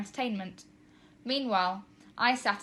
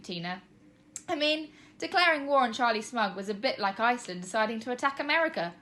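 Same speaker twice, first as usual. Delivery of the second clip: ordinary delivery, nothing marked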